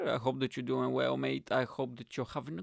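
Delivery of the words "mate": ordinary talk, nothing marked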